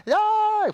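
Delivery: high loud mock wail like a child losing its sweet; mock child's wail. Preacher mocking a sulking child, high mock wail. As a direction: squeal out a hammed-up baby cry. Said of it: "Comienzas a llorar".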